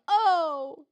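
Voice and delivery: exaggerated theatrical "oh"; mock loud "oh". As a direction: send up actors' open vowels. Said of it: "everyone's always going".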